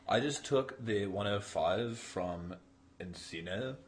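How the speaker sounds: slow, drawn-out mock Californian accent; Californian sketch accent. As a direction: drag the words in a spoofed SoCal accent. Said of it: "they just talk with that bizarre accent".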